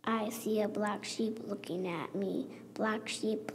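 plain reading voice

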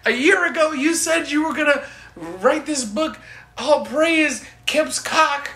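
high, loud mock accusation; outraged cult member. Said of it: "one of my cult members bring this up".

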